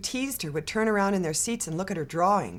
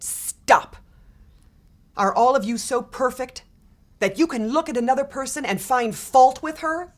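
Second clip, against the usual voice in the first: louder, higher scolding teacher; stern rebuke; 7 semitones higher, 6 dB louder than usual. A narrator's reading. Teacher scolding kids who laugh at Tricia, stern. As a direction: stop the class cold with a stern rebuke.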